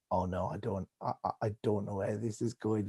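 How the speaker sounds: low, soft, faltering inner voice; quiet panic. Retold or imitated. Presenter who has lost the thread on live TV, quietly panicked. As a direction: imitate a presenter privately stumbling into dread.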